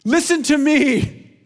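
high, loud slow cry; retold shout. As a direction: shout it out as the boy suddenly finding his voice. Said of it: "starts shouting praises to God".

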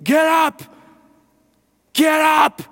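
high, loud frantic shout; wife screaming at her groggy husband. Retold or imitated. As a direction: scream him off the couch. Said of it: "she was screaming".

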